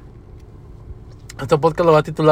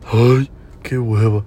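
low, drawn-out mock groan of reluctance; acted weary annoyance; 6 semitones lower, much slower than usual. Worker told to fetch his manager, retold weary reluctance. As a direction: sigh it out slowly, fed up.

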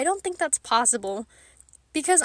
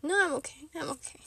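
whispered, much softer, fighting tears; holding back tears; 2 semitones higher, 5 dB quieter than usual. Real, not acted. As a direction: choke it down and whisper you're fine. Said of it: "Okay, I might cry".

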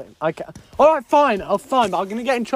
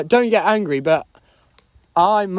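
normal talking voice

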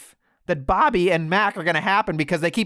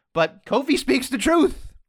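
ordinary talk, nothing marked

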